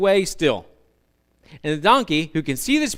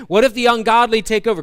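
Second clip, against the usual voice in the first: higher, louder mock fretting; mock worry; 6 semitones higher, 6 dB louder, faster than usual. Preacher mimicking anxious believers, higher louder mock fear. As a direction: voice the fearful worry.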